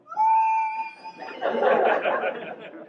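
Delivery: piercing high-pitched scream of laughter; imagined wife's wild shriek. Retold or imitated. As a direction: shriek like the wife would.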